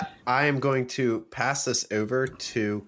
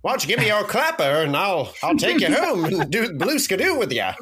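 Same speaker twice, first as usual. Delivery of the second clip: put-on higher, louder old-timey wise guy; nineteen-twenties guy; 6 semitones higher, 5 dB louder than usual. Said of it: "Is this guy from the nineteen twenties?".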